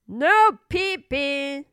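slow, loud warning; stern general. Acted. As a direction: warn like a gruff general. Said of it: "he said".

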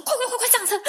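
high, loud, laughing mock yell of the driver; hammed-up "get in now" through a laugh; urgent driver's yell. Imitating the tattooed driver shouting at her to get in, laughing. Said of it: "他说".

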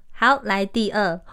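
normal talking voice

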